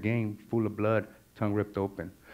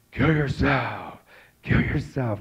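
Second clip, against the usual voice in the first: drawn-out, higher commanding voice; amplified inner voice; 4 semitones higher, 3 dB louder, slower than usual. Retold or imitated. Tormenting voice returns to man on freeway wall, amplified. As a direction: boom it like a voice in the head. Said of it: "this time it comes more amplified".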